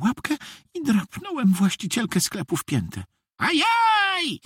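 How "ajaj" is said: very high yelp of pain; sudden high cry of "ouch"; pained shriek. A narrator's reading. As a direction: shriek as if scratched on the heel. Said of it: "wrzasnęła".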